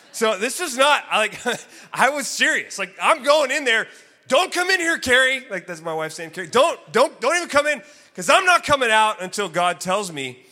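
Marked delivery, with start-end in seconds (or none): 3.0-5.4 loud, high call to keep out; husband calling out a firm warning; insistent warning. Retold or imitated. Husband warning his wife off before praying, insistent and loud. 6.5-10.4 loud, high, stammered "keep out"; insistent warning. Retold or imitated. Husband telling his wife to stay out, loudly insistent.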